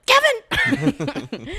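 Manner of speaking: high, loud cry of the name; yelling a name. Retold or imitated. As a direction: call out the name in alarm. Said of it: "and I was like".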